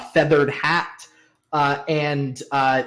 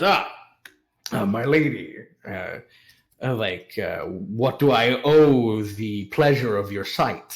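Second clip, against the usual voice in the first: courtly merchant; 4 semitones lower than usual. Acted. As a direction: flatter the lady with courtly manners. Plays merchant greeting a lady.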